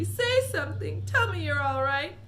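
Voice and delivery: high, loud desperate plea; crying out. A narrator's reading. Mother begging her son to speak, frantic. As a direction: beg him to answer. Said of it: "cried Missus T V".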